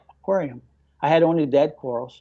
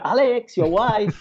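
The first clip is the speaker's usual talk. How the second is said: raised, higher call across the lab; calling out to him; 5 semitones higher, slower than usual. Retold or imitated. Coworker calling Alex to the phone, raised call.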